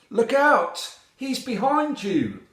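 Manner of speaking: high, loud alarm call; warning shout. A narrator's reading. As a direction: shout it as a frog raising the alarm. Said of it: "One of the frogs shouts out".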